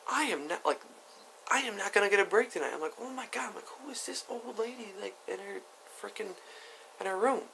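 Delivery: high-pitched, mostly softened complaint; acted dismay. Teller to brother about stranger outside room, high dismayed aside. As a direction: grumble high, keeping it down.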